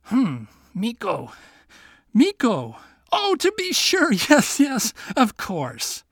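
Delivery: slow hmm into a high flustered yes yes; king bluffing he knows Miko; pretending to recall. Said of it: "he shook his head and murmured".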